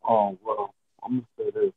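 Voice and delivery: muffled through a headset. Real, not acted. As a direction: sound muffled and far away. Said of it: "You, you sound muffled".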